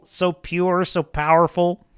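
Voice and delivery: normal talking voice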